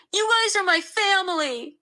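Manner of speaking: high, tearful wail. A narrator's reading. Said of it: "she wailed".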